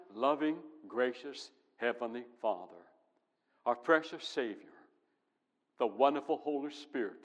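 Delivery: ordinary delivery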